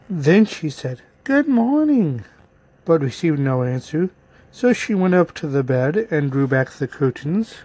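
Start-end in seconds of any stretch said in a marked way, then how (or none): 1.2-2.2 put-on high, slow girlish call. A narrator's reading. Girl greeting her unseen grandmother, high drawn-out call.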